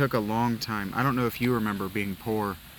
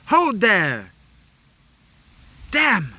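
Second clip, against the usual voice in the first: much higher, loud anime shout; hammed-up anime exclamation; anime hero cry; 11 semitones higher, 9 dB louder, much slower than usual. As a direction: yell it like an overdramatic anime hero. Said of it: "You know how anime is like".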